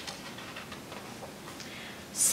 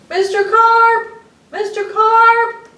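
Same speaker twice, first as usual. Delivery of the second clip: high, loud, slow calling out; frantic yelling; 14 semitones higher, 11 dB louder, slower than usual. Acted. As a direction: call across the pool. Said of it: "I yelled".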